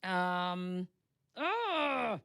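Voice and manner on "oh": high, exasperated groan; long high "ugh" of frustration; frustrated groan. Real, not acted. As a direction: groan at your own failing memory. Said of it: "this is driving me crazy".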